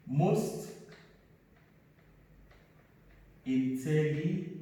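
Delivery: each word spaced out slowly for the class; slow dictation. Shown as a demonstration. Teacher to class, slow dictation. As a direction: dictate it slowly so students can write. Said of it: "So intelligent, we say".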